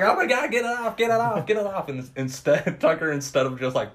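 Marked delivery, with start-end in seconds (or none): 0.0-1.9 high mock panic. Friend acting out Dale under the body, high panicky. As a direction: squeal get it off repeatedly. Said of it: "he's like".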